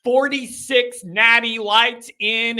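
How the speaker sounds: ordinary talk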